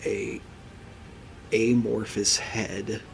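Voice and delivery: slow, hushed, suspenseful narration; game master scene setting. Acted. Game master revealing a creature to the players, slow ominous narration. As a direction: draw it out softly, with long pauses for dread.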